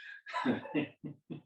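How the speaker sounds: soft high giggle. Real, not acted. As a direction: giggle at your own joke. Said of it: "when you can make yourself laugh".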